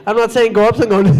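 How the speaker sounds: usual speaking voice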